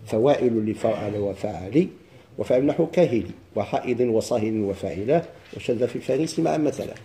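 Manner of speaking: measured, chanted reading of the verses; verse recitation. Acted. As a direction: recite the poem line by line.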